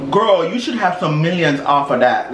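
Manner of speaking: ordinary talk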